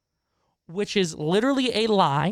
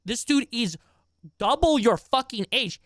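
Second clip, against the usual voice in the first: ordinary delivery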